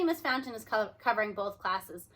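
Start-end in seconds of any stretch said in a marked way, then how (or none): none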